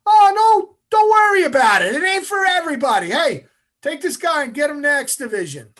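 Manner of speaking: high, loud mock cheer; booming, over-friendly send-off; suddenly happy instructor. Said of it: "a happy guy".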